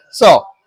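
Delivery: सौ with a light plain s; simple s sound. Shown as a demonstration. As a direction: say सौ with no puff of air. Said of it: "कोई हवा वो मुंह से नहीं निकाली है".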